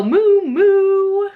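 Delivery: high, loud, drawn-out moo; cow impression. Reader shows a child cow sounds, cow impression. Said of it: "He can sound like a cow".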